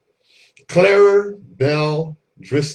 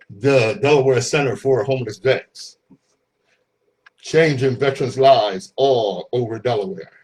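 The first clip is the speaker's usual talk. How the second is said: ordinary talk, nothing marked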